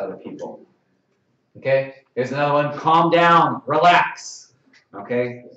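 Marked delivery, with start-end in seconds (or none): 2.8-4.5 loud mock order; forceful calming command. Shown as a demonstration. Ref wrongly trying to settle someone, loud command. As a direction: order it loudly, as if bossing someone. Demo of phrases to avoid.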